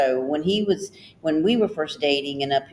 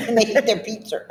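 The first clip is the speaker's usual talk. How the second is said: higher echo, Boston 'pizza' ending in R; Boston accent; 4 semitones higher than usual. Retold or imitated. Listener echoing the Boston phrase, mimicked Boston accent. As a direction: echo it with a Bostonian 'peetser', amused. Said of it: "they put R on that one".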